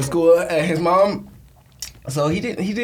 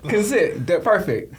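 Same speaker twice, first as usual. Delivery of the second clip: ordinary talk, nothing marked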